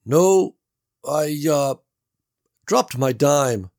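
Gary calling through the wall; Gary's voice. A narrator's reading. Gary admits dropping dime.

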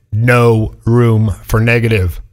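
"No room for negative" drawn out, low and firm; slow emphatic repeat. Real, not acted. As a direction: spell it out slowly, word by word. Slow repeat to drive it home.

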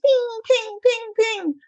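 high, chiming ping sounds; pinging phone notifications; imitated phone pings. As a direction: mimic message alerts. Imitating message alerts.

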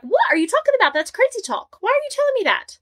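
high, rising disbelief; mock incredulous, pitched high. [name]'s incredulous reply.

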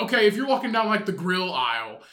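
ordinary delivery

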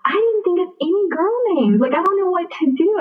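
flustered panic. Retold or imitated. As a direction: sound caught completely off guard. Panics about having no girl names.

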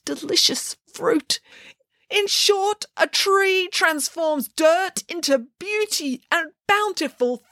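slow wistful elegy, whispering at the end; grieving pig Anna lamenting. Acted. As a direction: mourn the tree as a thing of beauty. Anna's long grieving speech.

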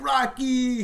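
high mock shout of the kids. Father imitating kids cheering his son's name, high shout. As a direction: call out the name like a cheering kid. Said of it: "the kids were like".

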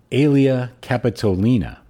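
ordinary talk

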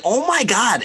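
a high, exasperated oh my God; retold disbelief, voiced high; high exasperated gasp. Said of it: "I was like".

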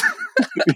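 a sudden high burst of laughter. Real, not acted. Laughing at the King of the Hill joke.

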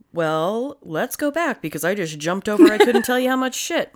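ordinary talk

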